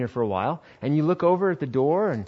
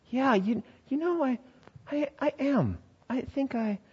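much higher, softer, preening yes; smug agreement; 12 semitones higher, 8 dB quieter than usual. Acted. Fill-in pastor accepting praise, high smug coyness. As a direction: let the pride slip out coyly.

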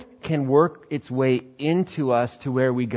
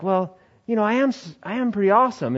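higher, preening and self-satisfied; smug self-regard; 8 semitones higher than usual. Acted. Young pastor convinced of his greatness, high smug voice. Young man believing the hype.

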